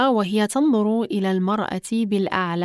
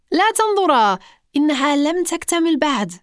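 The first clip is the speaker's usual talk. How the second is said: put-on high-pitched, urgent boy's cry; calling out; 6 semitones higher than usual. A narrator's reading. Boy stopping visitors from seeing his unfinished cake, crying out. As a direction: cry out high, without full shouting. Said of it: "يصيح سبي قائلًا".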